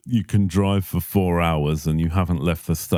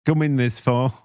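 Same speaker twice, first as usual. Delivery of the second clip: higher-pitched Mancunian trailer line; Manchester accent; 6 semitones higher than usual. Acted. Joking that trailers fail in Manchester, mock Mancunian accent. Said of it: "from Manchester, could you?".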